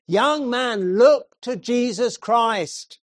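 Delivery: loud high call, slow on look to Jesus Christ; shouted appeal. Acted. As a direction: shout it out to the young man. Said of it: "Then he shouted".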